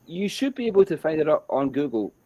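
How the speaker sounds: plain reading voice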